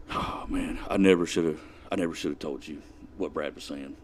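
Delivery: soft, low, regretful mumble; low, sheepish near-whisper; regretful murmur. Retold or imitated. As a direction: confess it quietly, sorry you ever spoke. Brett's regretful admission, retold.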